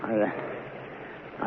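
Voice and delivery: breathless stammering whisper; terrified husband stuck for words; scared stammer. Acted. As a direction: choke on the first word. Frightened stammer.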